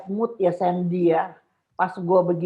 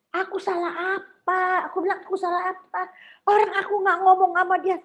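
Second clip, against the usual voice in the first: high, indignant protest; 11 semitones higher, faster than usual. Retold or imitated. Defending herself to her friend, high and indignant. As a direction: insist you did nothing wrong.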